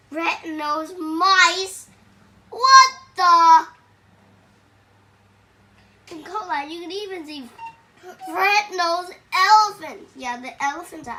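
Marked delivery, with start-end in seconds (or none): 0.1-1.9 emphatic. Acted. Reading aloud, emphatic line. 2.5-3.7 startled, loud exclamation; loud surprised outburst. Real, not acted. Surprised outburst while reading. 8.3-10.1 loud excited storybook reveal; loud emphatic. Acted. Reading aloud, emphatic line.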